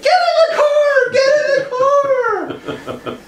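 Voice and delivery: shrieked falsetto order, slow and drawn out; hammed-up screech at top volume; mock frantic scream. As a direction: scream it like a panicked rescuer. Friend screams orders, imitated.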